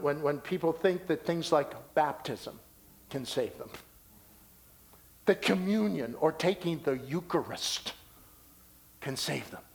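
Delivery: ordinary talk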